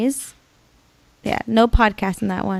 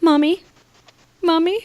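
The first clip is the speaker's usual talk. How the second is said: put-on higher, pleading toddler calling mommy; scared little girl; 7 semitones higher than usual. Mom imitating her scared daughter calling back, pleading child voice. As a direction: beg like a frightened child. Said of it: "she was just like".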